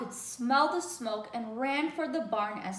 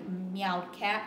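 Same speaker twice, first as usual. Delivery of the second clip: plain reading voice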